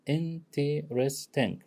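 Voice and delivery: "Interesting" drawn out slowly so each of its four syllables is heard; slow syllable demo. Shown as a demonstration. Teacher slowly spells out "Interesting" syllable by syllable for the class. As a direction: say "Interesting" slowly, laying out in-ter-est-ing. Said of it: "four syllables".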